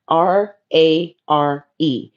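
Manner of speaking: slow letter-by-letter spelling of "rare"; spelled out. Shown as a demonstration. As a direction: spell out R A R E slowly, one letter at a time. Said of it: "You're rare".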